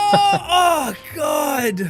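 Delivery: oh god. Real, not acted. Cringing at his old video.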